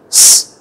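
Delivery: "s" as a long hiss; voiceless S. Shown as a demonstration. Teacher contrasting two sounds, voiceless S. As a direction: hiss "s" without any voice. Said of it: "âm S và âm giờ".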